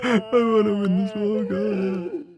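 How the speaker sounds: high, wailing mock sob; fake crying. Streamer mocks viewers wanting to win, fake crying. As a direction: cry it out high like a sore loser.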